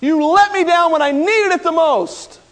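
high, loud furious shout; a betrayed master yelling at his disciple; angry rejection. Pretend. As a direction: throw him out with full fury. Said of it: "Jesus could have said".

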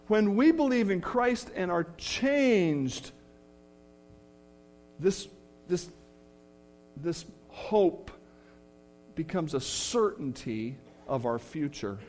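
ordinary talk

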